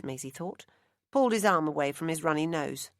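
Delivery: ordinary delivery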